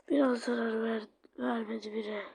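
soft, scornful whisper; the tough doll whispering it felt nothing; smug whisper. Acted. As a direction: breathe the taunt, unimpressed. Tough character mocking the attack.

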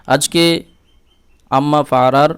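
ordinary talk

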